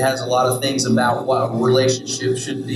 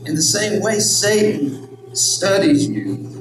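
normal talking voice

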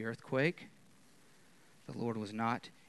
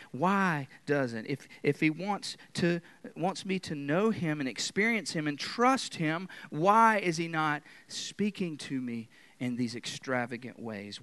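ordinary talk

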